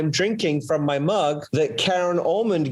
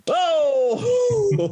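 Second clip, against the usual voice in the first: excited whoop. Real, not acted. Reacts to own joke.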